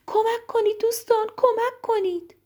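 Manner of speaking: very high-pitched storybook plea; frantic rabbit's high call; calling for help. A narrator's reading. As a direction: cry out high and urgent to friends.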